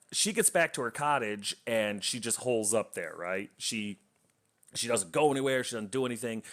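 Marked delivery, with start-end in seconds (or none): none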